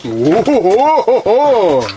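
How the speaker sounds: slow, drawn-out delighted hoot; excited whoop. Real, not acted. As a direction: whoop at the catch. Big fish pulled from the ice.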